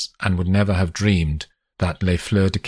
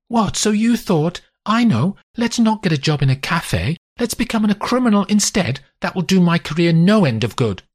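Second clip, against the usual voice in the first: higher, mocking wife's voice; mock his excuse, pitched up; sarcastic wife; 13 semitones higher, 3 dB louder than usual. A narrator's reading. Wife mocking husband's reasoning.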